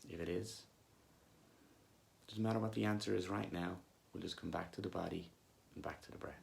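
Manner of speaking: soft, unhurried meditation voice with long pauses; guided meditation. Acted. Coach leading listeners back to body and breath, calm guided meditation. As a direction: gently guide them back to the breath, leaving long silences.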